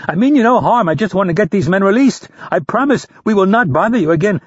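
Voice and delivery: pleading. A narrator's reading. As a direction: talk a captor down, begging. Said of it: "Rapp pleaded".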